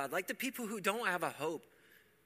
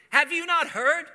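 much higher and louder, ringing out; preacher's shout; 11 semitones higher, 13 dB louder than usual. Shown as a demonstration. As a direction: fling the question at the whole church. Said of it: "Do you hear the difference".

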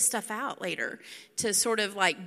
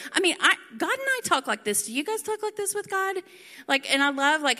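ordinary delivery

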